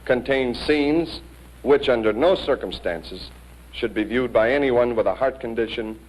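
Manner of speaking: ordinary talk, nothing marked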